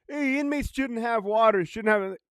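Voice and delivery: high-pitched mocking imitation; mocking bottom feeders. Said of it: "bottom feeders are like".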